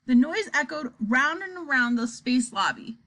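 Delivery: ordinary delivery, nothing marked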